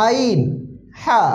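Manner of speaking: আইন and হা squeezed from inside the throat; drawn-out আইন, then হা, both made deep in the throat; deep throat sounds. Shown as a demonstration. Teacher drilling two throat letters, আইন and হা from inside the throat. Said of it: "উচ্চারণের দিকে একটু খেয়াল করি".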